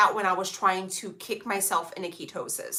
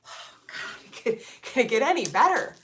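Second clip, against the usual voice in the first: 1 swooning gush; 4 semitones higher, 4 dB louder than usual. Retold or imitated. Swooning over British accent.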